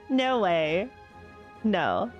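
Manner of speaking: ordinary talk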